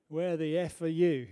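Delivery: loud swearing demand on the phone; a drunk man yelling at the ambulance service, hammed-up anger; mock angry shout.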